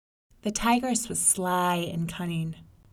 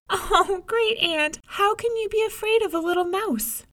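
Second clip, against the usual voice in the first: put-on much higher, amused child voice; teasing little girl; 13 semitones higher, 4 dB louder than usual. May May teases the tigress about the mouse, high and amused. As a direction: tease the scared aunt playfully. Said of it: "May May burst out laughing".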